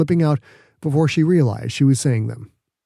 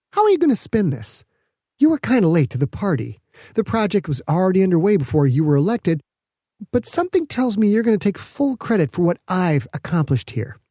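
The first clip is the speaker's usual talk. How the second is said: higher, needling challenge to the governor; a scientist baiting the governor, raised pitch; Petra, sharp; 6 semitones higher than usual. Acted. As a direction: push back with pointed sarcasm.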